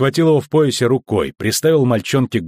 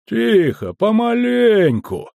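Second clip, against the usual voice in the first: higher, long drawn-out vowels; slow, distinct drawl; 11 semitones higher, much slower than usual. A narrator's reading. Customs officer ignoring the shouting, slow and distinct. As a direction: stretch it out, unhurried and clear. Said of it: "не обращая внимания на крик, цедил слова медленно и чётко".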